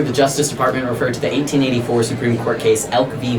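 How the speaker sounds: ordinary talk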